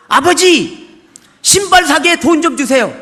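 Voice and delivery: put-on loud, blunt child's demand to God; demanding prayer. Child loudly demanding shoes from God in prayer.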